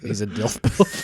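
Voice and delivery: giggling through the words; laughing. Real, not acted. Laughing repeat of phrase.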